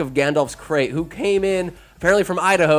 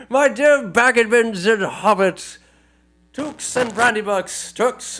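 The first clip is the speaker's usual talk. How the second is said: Bilbo's speech; 7 semitones higher, slower than usual. Retold or imitated. Bilbo voiced giving speech.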